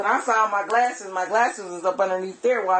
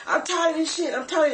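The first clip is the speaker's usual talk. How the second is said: higher, mild mock yell of the boyfriend; retold yelling; 7 semitones higher than usual. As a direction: echo his yelling, pitched up but not full force. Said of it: "he's steady yelling".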